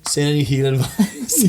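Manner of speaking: slow words through a laugh. Real, not acted. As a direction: chuckle it out slowly. Joking, laughing.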